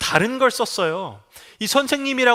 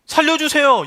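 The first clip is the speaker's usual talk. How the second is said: higher, louder desperate plea; desperate cry for help; 8 semitones higher, 5 dB louder than usual. Pretend. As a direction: call out like a drowning man begging. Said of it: "물에 빠졌는데".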